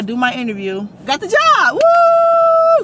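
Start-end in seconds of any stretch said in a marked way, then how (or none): 1.0-1.7 loud, high victory shout; triumphant. Real, not acted. Announcing landing the job. 1.7-2.8 loud, high celebratory whoop; triumphant woo yell; victory whoop. Real, not acted. Celebrating getting the job.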